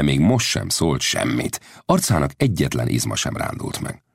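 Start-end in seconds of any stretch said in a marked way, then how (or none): none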